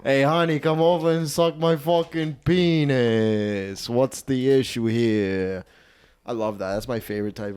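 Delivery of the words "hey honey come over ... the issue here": very drawn-out Italian-American come-on; Italian guy. Shown as a demonstration. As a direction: stretch each phrase like a crude Italian lover. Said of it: "That's what I'm being right now".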